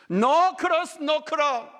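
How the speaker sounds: high, loud ringing proclamation; 크게 외치듯 선포; proclaimed slogan. Acted. English slogan climax.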